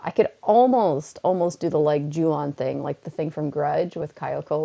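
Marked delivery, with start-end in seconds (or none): none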